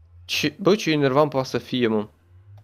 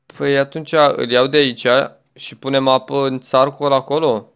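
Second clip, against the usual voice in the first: ordinary delivery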